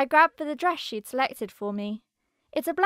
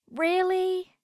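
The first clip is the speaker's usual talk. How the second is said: high, drawn-out cry of disbelief; dismayed cry; 7 semitones higher than usual. A narrator's reading. Narrator looking down at herself in borrowed nightwear, dismayed cry. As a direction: cry it out in dismay, pitched well up. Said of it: "I cry as I look down at myself".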